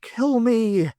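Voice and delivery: high, loud urgent plea; urgent begging. Acted. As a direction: beg for death, pressing. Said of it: "begged Kelly urgently".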